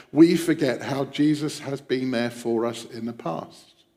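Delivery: normal talking voice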